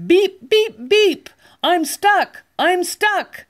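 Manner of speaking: high, loud frantic beeps; panicked beeping. Acted. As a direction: beep out in a panic. Stuck truck calls for help.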